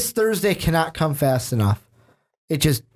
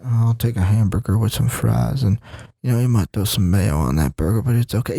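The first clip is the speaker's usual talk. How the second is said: Bill Belichick impression; 6 semitones lower than usual. Host voices Belichick ordering a hamburger, low mock drone. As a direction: sink the pitch down, answer like a tired coach. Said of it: "that's Bill Belichick right there".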